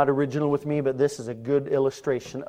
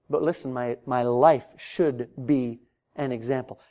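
ordinary talk, nothing marked

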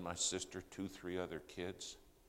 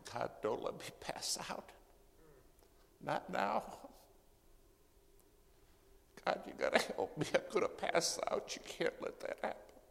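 high, strained plea through pain; retold agonized prayer, voice straining high; anguished screamed prayer; 10 semitones higher than usual. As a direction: cry out to God in desperate pain. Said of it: "screamed in pain. I mean, screamed".

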